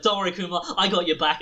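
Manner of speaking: mock heroic reassurance. Friend pretending to help hack, hammed-up heroic. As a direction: play the brave sidekick stepping in.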